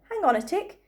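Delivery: a light, busy fairy; brisk fairy voice. A narrator's reading. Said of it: "The fairy said".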